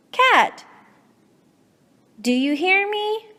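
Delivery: calling to a cat that won't listen. Real, not acted. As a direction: call out to get Cat's notice. Calling Cat who ignores her.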